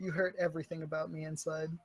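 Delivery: very soft, low aside; mock wounded. Player to game master after a setback, mock-hurt murmur. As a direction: say it small, pretending to be hurt.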